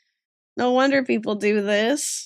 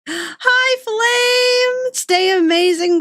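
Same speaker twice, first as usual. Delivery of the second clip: high, gasping sing-song hello; excited greeting; 11 semitones higher, 3 dB louder, much slower than usual. Real, not acted. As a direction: greet a friend with delighted surprise. Greeting a viewer who drops by.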